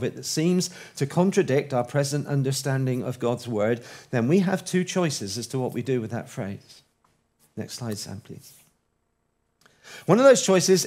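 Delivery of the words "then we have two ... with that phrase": ordinary talk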